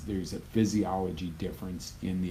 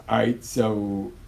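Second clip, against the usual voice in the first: ordinary talk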